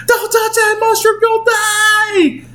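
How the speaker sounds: very high squeaky mushroom creature; Toad. Retold or imitated. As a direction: squeal a panicked warning in imitation. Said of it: "the guy that plays Toad".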